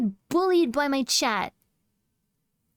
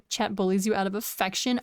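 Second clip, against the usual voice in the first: ordinary delivery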